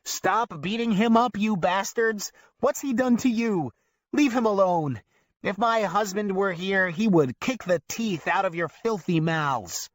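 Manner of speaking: put-on furious old lady yelling at the movie; old woman screaming. A narrator's reading. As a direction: scream at the hoodlums on screen. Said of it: "we hear an old woman in the audience scream".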